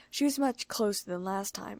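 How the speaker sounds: ordinary delivery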